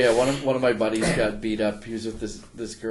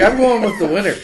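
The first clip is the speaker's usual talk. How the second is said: high, loud, gleeful impersonation; mock girl voice; 9 semitones higher, 9 dB louder than usual. Girl leaving with the fight winner, loud high mimicry.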